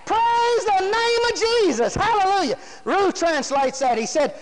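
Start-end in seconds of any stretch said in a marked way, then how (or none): 0.1-2.6 high, drawn-out praise shout; top of the voice. Acted. Healed man glorifying God at the top of his voice. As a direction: cry it out slowly at full pitch. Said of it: "at the top of his voice".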